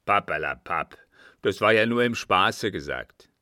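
loud, brushing it off; quick dismissive retort. A narrator's reading. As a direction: wave the worry away at once. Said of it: "antwortete er rasch". (rasche, laut abwinkende Antwort)

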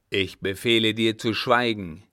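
loud, stern order; commanding captain. Acted. Father ordering his son to be silent, commanding. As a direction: lay down the order forcefully.

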